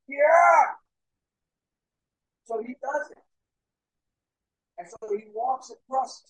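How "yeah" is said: high, loud acted cheer; crowd's shouted yes. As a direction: shout back like an eager crowd. Crowd answers the daredevil eagerly.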